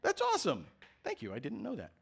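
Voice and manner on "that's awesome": high excited praise. Real, not acted. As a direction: gush over the birthday news. Child's birthday news.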